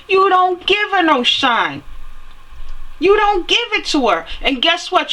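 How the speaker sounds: indignant rant. Real, not acted. Ranting about unfair treatment.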